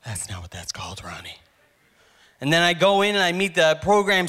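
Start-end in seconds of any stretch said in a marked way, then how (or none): 0.0-1.4 quiet muttered aside. Real, not acted. Under-the-breath correction aimed at Ronnie, quiet.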